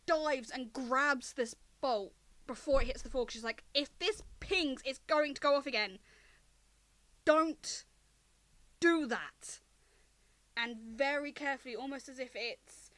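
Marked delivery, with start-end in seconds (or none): 3.7-9.6 loud, emphatic scolding; stern warning. Acted. Loma warning Winston the bolt could go off, stern and loud. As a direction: warn forcefully, leaning hard on each word.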